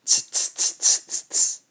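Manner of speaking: unvoiced tongue-click tsks; monkey tsking. A narrator's reading. Monkeys answer the peddler back, tsking. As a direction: tut like a mimicking monkey. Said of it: "monkeys ... said".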